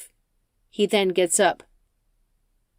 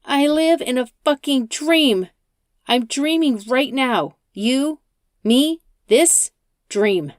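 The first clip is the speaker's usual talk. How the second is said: higher, louder shout, slowing at the end; 6 semitones higher, 4 dB louder, slower than usual. Acted. Rachel shouts at the driver about living in a dream. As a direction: yell it out, unhinged. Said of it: "Rachel shouts".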